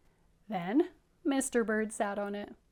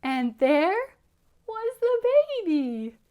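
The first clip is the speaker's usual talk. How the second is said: loud, high storybook announcement; big reveal; 9 semitones higher, 13 dB louder than usual. A narrator's reading. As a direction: build to the reveal with pauses. Big reveal of hatched baby.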